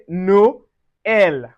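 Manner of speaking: "Noël" slowly as no, el; separated vowels. Shown as a demonstration. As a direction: break "Noël" into two distinct vowels. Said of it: "So we say".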